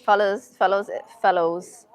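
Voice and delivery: normal talking voice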